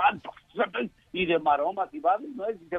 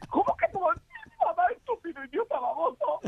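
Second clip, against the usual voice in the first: much higher mock woman's voice; shrill wife scolding. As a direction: berate him in a high put-on woman voice. Wife in high falsetto scolding.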